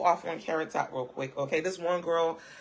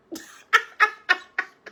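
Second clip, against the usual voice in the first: loud, high squealing laugh; high cackle; 9 dB louder than usual. Real, not acted. Vlogger cackling at her own joke, loud and high.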